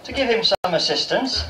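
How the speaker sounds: normal talking voice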